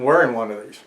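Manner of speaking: usual speaking voice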